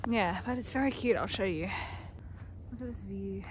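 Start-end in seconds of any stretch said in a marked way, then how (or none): 2.7-3.4 whispering. Real, not acted. Hushed aside at fortress view.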